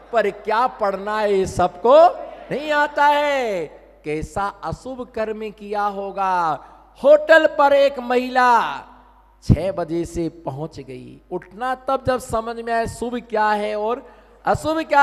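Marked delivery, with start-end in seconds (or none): none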